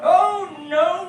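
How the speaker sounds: ordinary delivery, nothing marked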